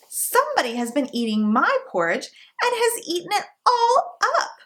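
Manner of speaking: put-on high, tiny baby bear voice, close to tears; baby bear's high wee voice, nearly crying; little small wee voice. A narrator's reading. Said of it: "in his little small wee voice".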